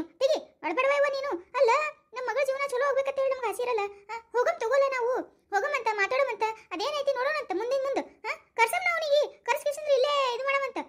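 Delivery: high-pitched, insistent reassurance; rally her out of tears, pitch well up; agitated, high-voiced comforting; emphatic consoling. Relative urges crying sister on.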